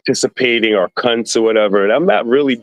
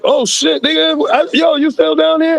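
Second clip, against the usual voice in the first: pitched way up, amazed to see him; high surprised greeting. Retold or imitated. Man spotting a hustler he thought was deported, high-pitched surprise. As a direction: squeal it like you can't believe he's still there. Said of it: "And I was like".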